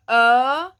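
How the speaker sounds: loud "ö" from deep in the throat; deep vowel. Shown as a demonstration. Teacher showing ö sound, deep. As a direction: let "ö" vibrate low. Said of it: "the sound comes really deep".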